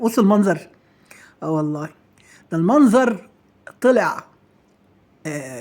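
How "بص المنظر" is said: ordinary delivery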